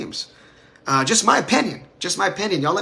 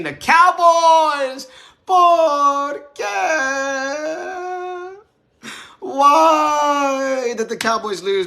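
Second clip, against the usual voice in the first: dramatic shout; 10 semitones higher, much slower than usual. Acted. Dramatic rhetorical outro cry.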